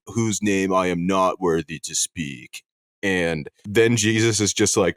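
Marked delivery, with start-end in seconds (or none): 0.1-2.6 solemn Judas. Retold or imitated. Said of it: "Judas supposedly stands up".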